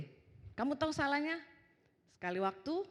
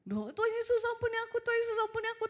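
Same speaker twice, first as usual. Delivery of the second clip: high, hurried imitation of a pleading child; mock-crying child begging forgiveness; menangis minta ampun; 12 semitones higher, faster than usual. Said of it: "Nangis langsung minta ampun". (tiruan anak memohon ampun, nada tinggi)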